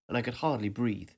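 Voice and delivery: ordinary delivery, nothing marked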